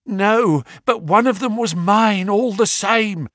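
high, lightly whining plea; a timid man's high complaint; small, nervous voice pleading his case; whining little man. A narrator's reading. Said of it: "whined the little man".

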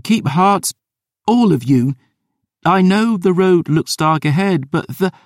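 put-on rousing young leader; rising, rallying call. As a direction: lift their spirits aloud. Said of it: "her voice rising".

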